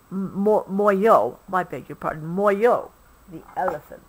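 ordinary delivery, nothing marked

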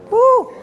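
high joyful whoop; excited whoop. Real, not acted. Preacher whoops at the crowd, high joyful whoop. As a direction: let out a shout of joy.

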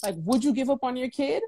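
ordinary talk, nothing marked

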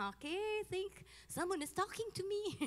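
amazed realization. Retold or imitated. Retold realization, higher voice.

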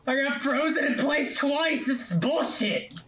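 high exasperated shout; ranting at the game; frustrated yell. Real, not acted.

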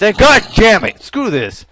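loud, high burst of exasperation; frustrated yell. Real, not acted. Gamer fed up with the game, frustrated yell. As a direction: explode in frustration, then ease off.

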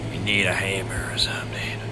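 quick, soft whisper. Real, not acted. Whispered repeat.

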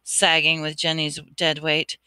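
plain reading voice

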